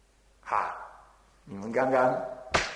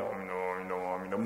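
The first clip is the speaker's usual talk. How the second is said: 阿弥陀佛 barely voiced, low and much softer; silent recitation; 11 semitones lower, 9 dB quieter, much faster than usual. Shown as a demonstration. Teacher showing the assembly silent mental recitation. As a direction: murmur it almost inaudibly, inward. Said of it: "心中默念".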